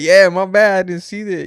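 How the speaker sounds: high, loud sheepish reply; mock innocent self. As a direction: play your past self acting innocent. Quoting own reply to cop.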